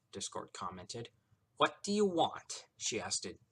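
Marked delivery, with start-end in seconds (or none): 1.6-2.6 high, loud, exasperated demand; through gritted teeth. A narrator's reading. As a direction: force it out through clenched teeth. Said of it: "through her gritted teeth".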